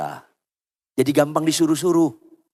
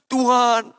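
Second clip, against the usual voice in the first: high, imitated wail to God; retold tearful plea; 9 semitones higher than usual. Preacher recalling his cry to God on reading the verse, pleading. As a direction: plead upward, acting out past weeping. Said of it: "nangis saya, nangis, nangis".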